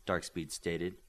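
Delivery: usual speaking voice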